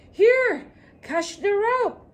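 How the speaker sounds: high, loud rescue shout, drawn out slowly. Acted. Rescuer shouts down to the floating traveller, high and loud. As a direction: call down urgently from above. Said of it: "when someone high shouted".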